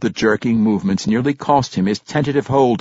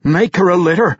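raised, commanding tone; higher-pitched command to a comrade; ordering; 6 semitones higher, 3 dB louder than usual. A narrator's reading. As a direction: give a firm order. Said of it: "he ordered".

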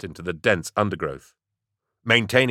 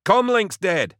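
very high, loud shouted report; shouting; 12 semitones higher, 4 dB louder, slower than usual. A narrator's reading. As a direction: yell the bad news. Kafran yelled.

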